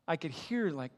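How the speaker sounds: normal talking voice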